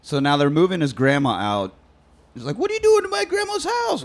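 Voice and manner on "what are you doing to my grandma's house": very high mock-outraged voice; indignant grandson. Grandson to people moving grandma out, indignant. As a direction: squeal it as an imitated protest. Retold character confronting movers.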